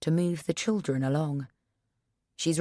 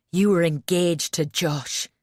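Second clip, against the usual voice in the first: fuming; 4 dB louder than usual. A narrator's reading. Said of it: "he fumes".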